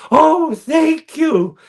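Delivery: very high, slow, loud, overwhelmed thank you; overwhelmed gratitude. Retold or imitated. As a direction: gush thanks as if near tears. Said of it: "You're just like".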